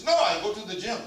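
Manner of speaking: put-on French accent. French lady refusing help with luggage, imitated French accent. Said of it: "with the French accent".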